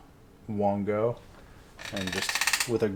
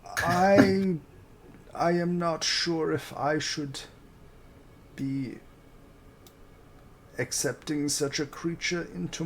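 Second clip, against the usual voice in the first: higher, wary voice for Orvex; Orvex character voice; 7 semitones higher than usual. Acted. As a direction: play him cautious and hesitant.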